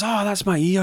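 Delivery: very high elf squeal; pained yelp. Acted. Elf whose ear is pulled protests, high pained yelp. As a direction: cry out as if yanked.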